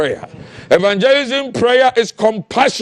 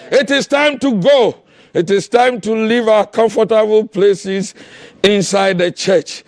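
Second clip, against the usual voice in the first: ordinary delivery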